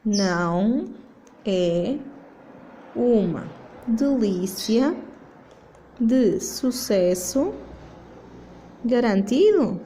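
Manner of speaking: words spaced out slowly, rising to the question. Shown as a demonstration. Teacher showing pupils the negative question, slowly spaced. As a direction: lay it out word by word for the class. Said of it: "então como é que fica?".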